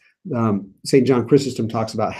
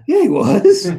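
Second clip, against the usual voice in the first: laughing, pitched up high; 8 semitones higher, 6 dB louder, slower than usual. Real, not acted. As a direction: laugh through the obvious answer. Teacher mocks obvious answer, laughing.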